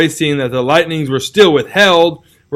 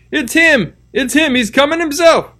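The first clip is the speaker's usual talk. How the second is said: crowd shouting; 13 semitones higher than usual. Acted. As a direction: cry it out as the suspect appears. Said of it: "voices shouted".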